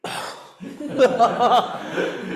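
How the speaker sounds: high, loud laugh bursting out; loud burst of laughter. Real, not acted. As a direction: laugh out at the absurd joke. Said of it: "It's impossible".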